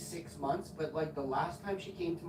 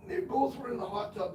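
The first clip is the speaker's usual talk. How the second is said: normal talking voice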